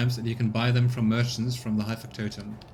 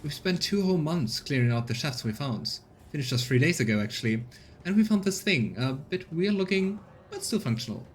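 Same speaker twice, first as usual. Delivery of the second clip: higher, lively woman's voice; colonist woman; 4 semitones higher than usual. Acted. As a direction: show off the find with pride. Voicing Rita.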